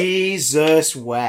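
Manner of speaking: slow, drawn-out disbelieving Jesus wept; exasperated groan. Real, not acted. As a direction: drag it out in weary disbelief. Exasperated at Class cliffhangers.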